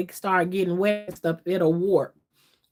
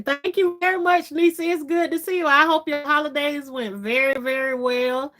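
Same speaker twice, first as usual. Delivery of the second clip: excited thanks; 7 semitones higher than usual. Real, not acted. Thanking the friend who just joined.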